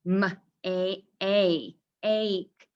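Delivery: "make" broken into "M, A, A, ache"; sounded-out long A. Shown as a demonstration. Teacher showing how to sort vowels, "make" sounded out. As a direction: repeat the "ay" vowel of "make" in separate stretched pieces. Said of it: "I keep repeating that sound".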